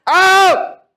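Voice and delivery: loud high commanding yell; preacher shouting a command at sickness. Shown as a demonstration. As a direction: yell it out with full authority. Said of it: "sumigaw ka".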